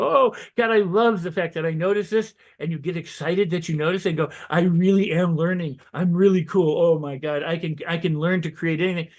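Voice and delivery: high, gushing delight at your own progress; over-the-top happy self-talk; enthusiastic. Pretend. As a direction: burst out with joy at noticing it. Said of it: "choose the new thought with enthusiasm".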